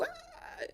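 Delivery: very high, soft mock scream; a short thin shriek of terror; retold scream. Telling how he woke up locked in, short high scream. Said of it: "chillando, chillando".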